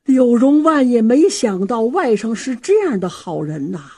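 usual speaking voice

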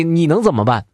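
plain reading voice

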